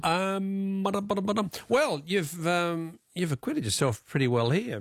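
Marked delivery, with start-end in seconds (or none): 0.8-1.5 mock suspense drumroll before the joke; drumroll imitation. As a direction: sing a playful ba-dum drumroll, higher. Host fills pause with drumroll.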